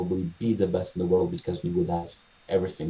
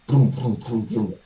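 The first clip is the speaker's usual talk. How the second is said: playful booming thuds, a little louder; bumping noises; 3 semitones higher, 4 dB louder, slower than usual. Retold or imitated. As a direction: mimic knocking into every chair. Imitating bumping into chairs.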